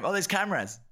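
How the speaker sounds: "cameras" with a falling, laughing end; imitating the cameras. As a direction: say "Oh, there's cameras" mockingly, laughing. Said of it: "I like how you say it".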